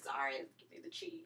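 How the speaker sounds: usual speaking voice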